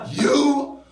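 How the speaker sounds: high, very slow stern teacher; angry teacher. Pretend. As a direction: spit out each word slowly. The teacher's insult acted out.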